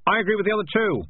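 high-pitched call from the crowd; shouted agreement. A narrator's reading. Third voice in the crowd backing the others, calling out. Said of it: "someone else shouted".

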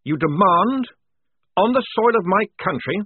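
defiant dressing-down, rising in pitch; indignant tirade. Acted. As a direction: build to righteous outrage at the bully. Lieutenant rounds on the enemy captain.

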